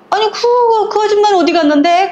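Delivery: high-pitched, quick acted old woman; busybody grandmother. Neighbourhood grandmother questions the woman minding a stroller, incredulous.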